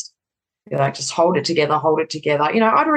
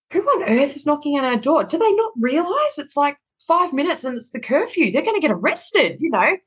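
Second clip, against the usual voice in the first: pitched way up, alarmed and baffled; startled disbelief; 10 semitones higher than usual. Retold or imitated. Wife hearing a knock at the door just before curfew, high alarmed disbelief. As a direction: sound shocked someone's knocking so late.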